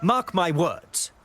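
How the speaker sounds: deliberate ominous warning; stern threat. Acted. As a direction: lay down a vow of revenge. Threatening the Montelli daughter.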